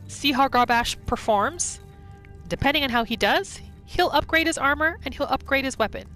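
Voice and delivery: usual speaking voice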